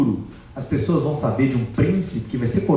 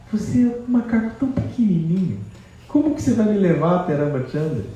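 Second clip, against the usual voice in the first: doubtful Sita; 9 semitones higher than usual. Acted. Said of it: "Aí ela fala".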